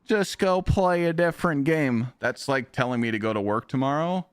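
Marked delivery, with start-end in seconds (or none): 0.0-2.1 high, slow whiny commenter; slow, sneering mock complaint; mocking hater. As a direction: drag it out like a smug hater. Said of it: "the heat I would get".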